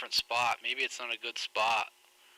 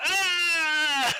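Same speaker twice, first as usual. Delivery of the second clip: sudden high scream, much louder; a startled shriek at the mouse vanishing; short, shrill burst of fright; retold scream; 7 dB louder than usual. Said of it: "all I hear is my wife and daughter".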